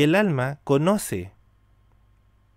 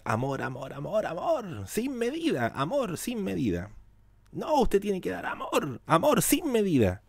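soft, higher sing-song mimicry of a preacher; mocking the religious teaching; 5 semitones higher, 6 dB quieter than usual. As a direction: lilt it mockingly, higher and soft, like a rote church lesson. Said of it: "¿Qué te enseñaron en la religión?". (imitando con burla, más agudo y suave, como lección de iglesia)

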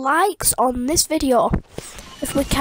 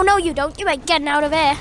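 ordinary talk

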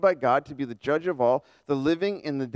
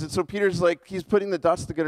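ordinary talk

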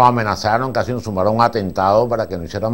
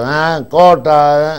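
drawn out cut; 5 semitones higher, 6 dB louder, much slower than usual. Retold or imitated. Director calling cut again.

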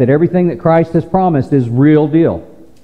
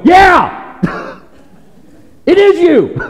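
excited shout; 12 semitones higher, slower than usual. Retold or imitated. Pastor jokingly answering the hymn, shouting with excitement. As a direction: burst out answering the hymn with joy. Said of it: "I always wanna go".

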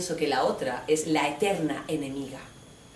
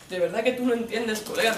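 mock bro voice; 3 semitones higher than usual. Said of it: "Ah, tío".

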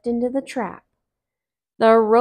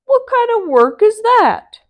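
Brer Bear; 13 semitones higher, 10 dB louder than usual. Acted. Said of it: "said Brer Bear".

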